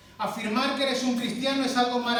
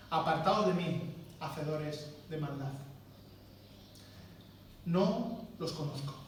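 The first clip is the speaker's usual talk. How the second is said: soft, slow, grave verdict; hushed final judgment; solemn and quiet; 5 semitones lower, 8 dB quieter, slower than usual. Shown as a demonstration. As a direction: let the sentence fall quietly. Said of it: "Y el Señor les contestará".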